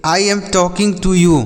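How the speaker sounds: each English word said slowly for the class; slow model sentence. Shown as a demonstration. Teacher to children, modelling an English sentence slowly. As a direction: spell it out slowly for learners. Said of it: "जैसे".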